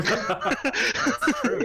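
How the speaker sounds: high squeaky laugh through the words; game master cracking up at a player's point, laughing. Real, not acted.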